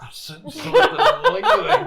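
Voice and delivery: laughing mock mystic. Mystic sensing joke, laughing.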